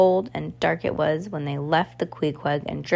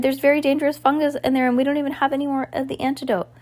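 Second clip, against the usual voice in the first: high, fast, alarmed; urgent warning; 9 semitones higher, faster than usual. Acted. Violet warning her siblings about the dangerous fungus, urgent. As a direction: rush the warning out.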